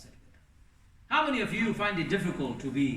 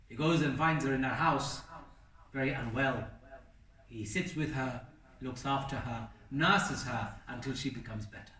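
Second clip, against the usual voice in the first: normal talking voice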